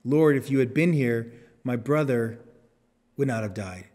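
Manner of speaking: ordinary delivery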